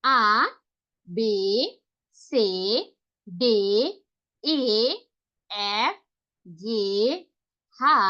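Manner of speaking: each German letter sounded out slowly with pauses; German alphabet read aloud. Shown as a demonstration. Teacher to online class, reciting the German alphabet slowly. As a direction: spell out the alphabet letter by letter, pausing between. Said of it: "আরেকবার".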